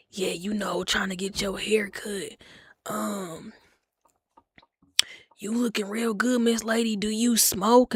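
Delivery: lightly voiced creepy barber; mock smooth flirt. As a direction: croon it like an older man hitting on her.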